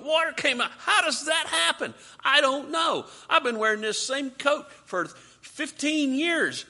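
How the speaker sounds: very high, loud, acted astonishment; mock amazement. Preacher plays Israelites astonished by water from rock, excited amazement. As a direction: exclaim in hammed-up disbelief.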